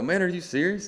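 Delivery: high, loud disbelieving question; incredulous. Retold or imitated. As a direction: retell being stunned by good news. Retold disbelief at big news.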